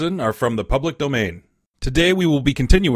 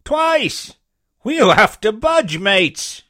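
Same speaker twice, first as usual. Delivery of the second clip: high, loud pirate voice; alarmed buccaneer; 9 semitones higher, 6 dB louder, much slower than usual. A narrator's reading. Buccaneer warning mates of danger, high urgent call.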